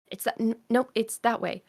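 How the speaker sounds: lightly voiced boy changing his mind; kid correcting himself. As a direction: catch your mistake like a child.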